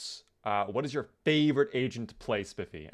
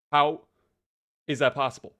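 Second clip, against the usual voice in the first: halting, word-by-word insistence; exasperated, each phrase set apart; exasperated demand; 3 semitones higher than usual. Real, not acted. Scolding the AI for disobeying.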